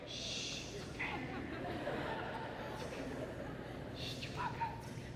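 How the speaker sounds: whispered shushing, very soft; hushed comforting. Retold or imitated. Speaker calming a sobbing girl, hushed comforting. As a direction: soothe them in a bare whisper, with pauses.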